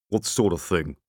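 big beefy man's growl. A narrator's reading. Big man to the short man beside him, growling. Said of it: "growled the other, a big, beefy person".

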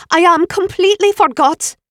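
put-on loud, high Swedish-accented panic; Ingrid's Swedish accent. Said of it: "in the Swedish accent".

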